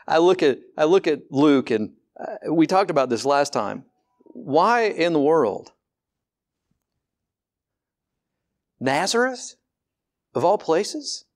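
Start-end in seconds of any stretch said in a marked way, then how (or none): 4.2-11.2 slow, then higher, questioning disbelief; incredulous. Acted. Preacher puzzling to congregation over God choosing Nazareth, incredulous. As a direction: puzzle aloud, pitch lifting on each question.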